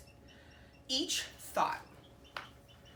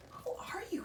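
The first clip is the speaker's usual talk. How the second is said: much higher, softer, curious; small high question; 13 semitones higher, 7 dB quieter than usual. A narrator's reading. Goose asking the egg who it is, high and soft. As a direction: ask the egg, tiny and high.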